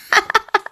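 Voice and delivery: short, high, soft giggle; high little chuckle; amused laugh. Real, not acted. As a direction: giggle briefly at own slip. Laughs at own mispronunciation.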